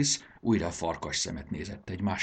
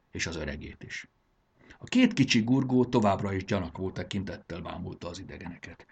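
ordinary delivery

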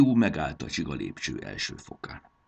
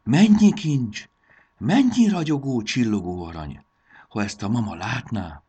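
amazed; 7 semitones higher, 9 dB louder than usual. A narrator's reading. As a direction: marvel aloud at a dazzling sight. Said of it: "ámuldozott Marci". (ámuldozó, magas, elnyújtott hang)

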